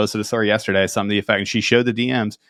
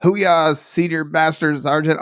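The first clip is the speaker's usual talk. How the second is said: mock eager DM; 7 semitones higher, slower than usual. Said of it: "people are like".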